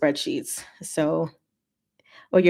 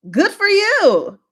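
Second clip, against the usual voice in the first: slow, much higher, drawn-out "Good for you"; mock congratulation; 14 semitones higher, 6 dB louder, slower than usual. Real, not acted. Streamer teasing a viewer, drawn-out mock congratulation. Teasing reader who started book.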